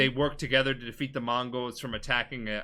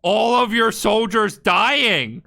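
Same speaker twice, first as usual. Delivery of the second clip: loud, slow, higher accusation; loud accusing quote; 6 semitones higher, 10 dB louder, much slower than usual. Retold or imitated. Jin throwing the soldiers' deaths back at his uncle, loud and slow. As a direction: hammer each word of the dead soldiers home.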